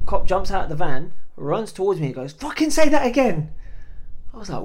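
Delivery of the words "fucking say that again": loud, raised challenge; aggressive cop. Retold or imitated. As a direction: confront someone as an angry cop, re-enacted.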